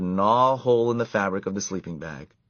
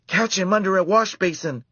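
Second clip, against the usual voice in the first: put-on excited girl shout; 11 semitones higher, 5 dB louder than usual. A narrator's reading. Girls chase mouse.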